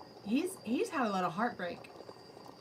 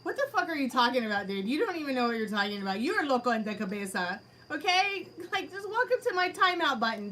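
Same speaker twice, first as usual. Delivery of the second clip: fast, louder, fed-up scolding; angry rant; 5 semitones higher, 4 dB louder than usual. Real, not acted. Reader scolds a chat troll during a paid reading. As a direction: tear into them, out of patience.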